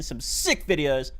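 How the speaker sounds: slow, drawn-out emphasis on sick; slowed-down hype. Acted. Streamer hyping upcoming videos to chat, slow and drawn out. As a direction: stretch "sick" out slowly.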